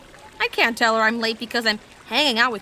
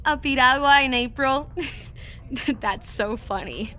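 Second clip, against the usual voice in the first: nervous laughing cover; 2 semitones higher than usual. Acted.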